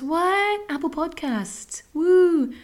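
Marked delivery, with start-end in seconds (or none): 0.0-0.6 sudden high squeaky what; mock surprise. Host feigning surprise mid plug. 1.9-2.5 loud high whoop; cheer. Real, not acted. Host cheering for the podcast charts.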